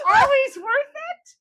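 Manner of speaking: slow laughing words. Real, not acted. Laughing answer in lightning round.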